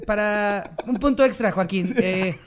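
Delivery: arranca lento y titubeante, remata fuerte entre risas; maestro cómplice. Acted. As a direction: imita a un profe que propone un soborno. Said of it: "el maestro es como así". (maestro de parodia, subiendo el volumen)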